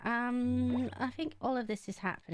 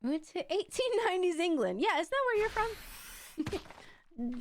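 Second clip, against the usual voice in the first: mock 1890s English accent; 9 semitones higher than usual. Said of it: "if this accent keeps up".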